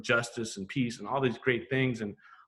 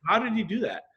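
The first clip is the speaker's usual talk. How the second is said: amazed; 9 semitones higher than usual. Retold or imitated. Marvelling at graffiti.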